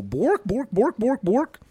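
high, bouncing Swedish Chef babble; imitated Swede's borking. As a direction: bork it high like a mock Swede. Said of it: "walk up to somebody and go".

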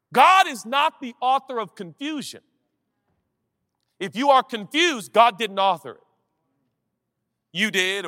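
high, loud forceful proclamation; fiery preaching. Acted. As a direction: preach it with full conviction.

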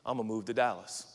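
low, modest statement of a plan; humbly submitted plan. Acted. Planner defers to what God wants, humble and low-key. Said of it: "you ought to talk like this".

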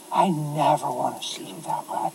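hushed whisper, close and tender; whispered vow. Retold or imitated. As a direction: breathe it to her like a promise. Tender apology to his wife.

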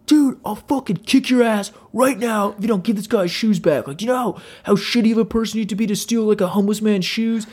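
high, outraged mock threat; imitating angry screaming. Huge dude threatening the supposed thief, angry mock yell. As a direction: tear into him as the huge dude. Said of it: "they're screaming at the top of their lungs".

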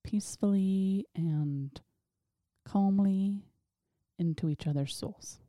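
slow, peaceful public-radio voice; calm NPR style. Pretend. Cohost opens the show on a cosmic journey, calm NPR style. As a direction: ease through it calmly with long pauses. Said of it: "calm, peace, N P R style".

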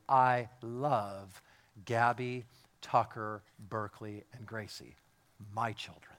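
low, softening roll of names; personal, particular love. Shown as a demonstration. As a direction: intimate and heartfelt, sinking in pitch. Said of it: "I mean something different when I say to you".

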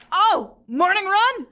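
high, slow, loud delighted cry; light up at the idea; excited Keith. Acted. Keith bursting in on the runners, high and delighted. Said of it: "He says".

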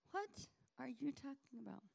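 high, puzzled question; baffled disciple. Acted. Disciples baffled by Jesus, high puzzled question. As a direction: ask it in bewilderment.

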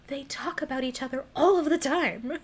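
high-pitched, emphatic incredulity; mock exasperation. MJ telling Peter she knew, exasperated. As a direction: spell out the obvious, playfully fed up.

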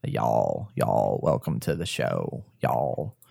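deep Texan welcome; Texas accent. Shown as a demonstration. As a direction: lay the Texas accent on thick, low and slow. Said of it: "my Texas accent".

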